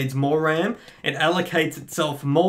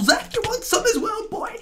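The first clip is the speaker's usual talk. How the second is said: high, cocky taunt at a rival; 12 semitones higher than usual. Real, not acted. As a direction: goad him into a fight. Taunting opponents mid-fight.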